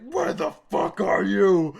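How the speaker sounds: loud, slow, punched-out demand; worked up. Retold or imitated. Storyteller reading out his angry message to Eric, loud slow demand. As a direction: re-enact an angry text, hitting each word. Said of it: "getting really worked up".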